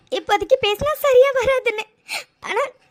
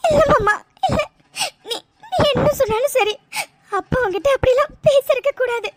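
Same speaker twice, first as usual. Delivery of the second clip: tearful protest. Acted. Girl to her uncle, insisting her father was wrong, crying. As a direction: protest through tears that he shouldn't have.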